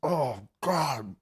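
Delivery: choked whimper. Real, not acted. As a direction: whimper through the struggle. Cry heard while eating.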